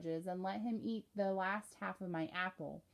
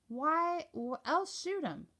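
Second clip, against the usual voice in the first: much higher, puzzled why else; as if the question is silly; country boy baffled by the question; incredulous; 7 semitones higher, slower than usual. Acted.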